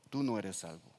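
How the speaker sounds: usual speaking voice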